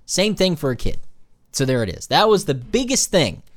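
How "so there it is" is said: ordinary talk, nothing marked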